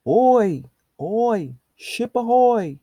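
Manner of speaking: loud, high, slow hail across the water; calling out to ship. Acted. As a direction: call out slowly to a distant ship.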